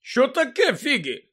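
puzzled question starting high and loud; surprised Мандангус. A narrator's reading. Mundungus to the old woman, surprised. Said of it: "здивувався він".

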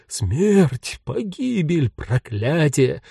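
drawn-out ghostly lament; muttering doom. A narrator's reading. Ghost bewailing death in several languages, muttered lament. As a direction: intone each word as a slow omen. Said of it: "забормотал что-то вроде".

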